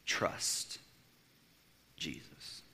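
a breath of a whisper. Real, not acted. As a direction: drop to a bare whisper. Preacher hushes on key words.

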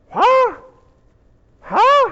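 very high, questioning "huh?"; baffled squeaks. Acted. Mary hears Elizabeth is pregnant, squeaking in confusion. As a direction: squeak it out, dumbfounded. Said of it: "At least she's making noise now".

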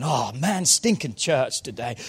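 low, put-upon morning grumble; reluctant parent dreading Sunday church; grumbling parent. Acted. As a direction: moan about having to go. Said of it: "how do you act when you get up Sunday morning?".

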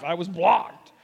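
playful protest, punching BLOCKED; mock indignation. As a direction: complain with hammed-up disbelief, hitting "blocked". Repeats it as mock outrage.

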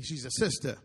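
"sister" said as "sista", r dropped; "sista" without the r. Shown as a demonstration. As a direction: end "sister" on an open "ah". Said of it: "not a sister".

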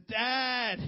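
loud high call to dad from bed; child shouting for his father; kid calling out. Retold or imitated. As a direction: yell for dad from the bedroom.

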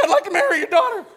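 very high, fast, cracking squeak, with a laugh; a would-be man's voice breaking upward; squeaky cracked voice. Retold or imitated. Redoing his proposal to his father-in-law, high cracked squeak. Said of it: "I'm trying to sound like a man".

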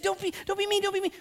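very high, loud, whining squeal; mock whiny plea. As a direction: whine it like a scared kid. Paul voiced as frightened.

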